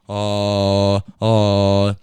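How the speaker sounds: "uh" as a long, loose Arnold vowel. Shown as a demonstration. Teaching listeners an Arnold voice, loose drawn-out "uh". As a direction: hold "uh" loose, tongue back in the throat. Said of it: "start off with a nice loose".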